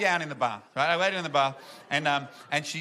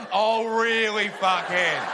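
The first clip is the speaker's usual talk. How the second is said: higher, mocking challenge; women being like; 5 semitones higher, 4 dB louder, much slower than usual. Comedian voices the laughing women, mocking challenge. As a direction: imitate scornful women, raised voice. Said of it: "That's the women being like".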